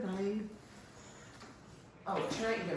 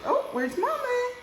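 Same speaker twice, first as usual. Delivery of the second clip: high, louder playful call; 4 dB louder than usual. Real, not acted. Playful baby talk to toddler.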